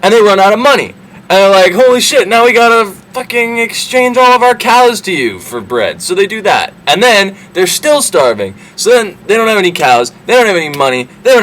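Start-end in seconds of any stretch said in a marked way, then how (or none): 1.7-6.0 mock dismay. Brothers forced to trade cows.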